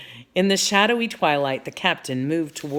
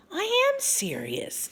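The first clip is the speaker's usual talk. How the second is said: very high, drawn-out protest; voice rising; slower than usual. A narrator's reading. Annie protesting to Jack, voice rising high. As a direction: let the pitch shoot up in indignation. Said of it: "her voice rising".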